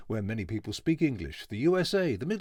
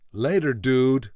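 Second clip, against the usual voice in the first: louder, slow laid-back American drawl on later dude; a hammed-up surfer goodbye; mock American sign-off; 2 semitones higher, 9 dB louder, slower than usual. Voice actor signing off with a mock American surfer farewell. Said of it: "until next time".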